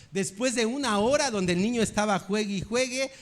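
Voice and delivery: usual speaking voice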